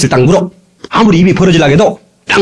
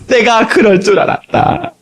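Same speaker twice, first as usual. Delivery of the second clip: slow drawn-out Lord's voice; 6 semitones higher, slower than usual. Acted. Lord's reply, said twice.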